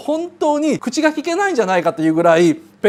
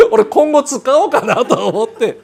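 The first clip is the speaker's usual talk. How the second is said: normal talking voice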